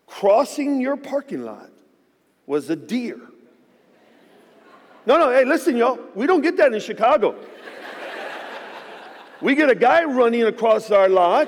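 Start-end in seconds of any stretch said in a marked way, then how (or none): 5.1-11.5 loud, high amazed call to the crowd; excited exclaim. Real, not acted. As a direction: tell them, wide-eyed and loud. Amazed at deer in lot.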